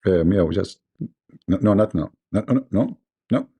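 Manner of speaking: normal talking voice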